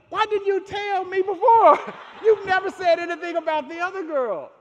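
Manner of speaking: loud, high laughing protest; incredulous protest. Retold or imitated. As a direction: push back at dad, amused and high. Retelling protest to dad.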